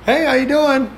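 high-pitched, casual mock hello; breezy greeting. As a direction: imitate an oblivious, easygoing greeting. Oblivious taxidermist greets him casually.

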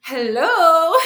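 put-on a playful, loud, high French-accented greeting; mock French hello. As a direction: imitate the French doctor saying hello, laughing. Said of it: "he's a French man".

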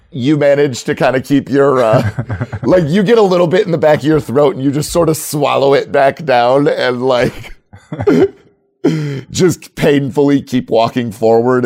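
barely holding back laughter mid-sentence; laughing while narrating. Real, not acted. GM cracking up at his own Uncle Ralph joke, laughing. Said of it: "Now who's the third grader".

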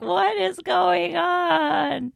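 high, drawn-out confusion; slow, loud disbelief; baffled exclaim. Retold or imitated. Retelling confused reaction to text.

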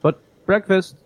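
high, stiff servant voice; robot butler. Acted. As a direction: announce breakfast like a polite machine.

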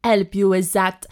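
put-on playful try at a French accent; French accent with dropped h and z for th; mock French accent. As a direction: copy the silly written French accent. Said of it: "now I can speak in French".

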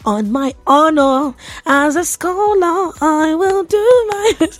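high, slow hammed-up oath of a scholar; mock solemn vow. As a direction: swear it like a pompous scholar, giggling at the end.

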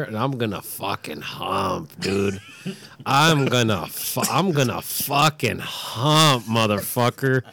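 mock threat.

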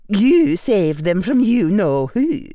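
high, loud, slow Scottish professor; precise Scottish accent. A narrator's reading. Professor reminding the boy he saved them, Scottish accent. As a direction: state it firmly in careful Scottish tones. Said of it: "in her precise Scottish accent".

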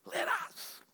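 a breathy whispered let us; hushed echo. Acted. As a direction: drop it to a whisper. Quiet echo after loud preaching.

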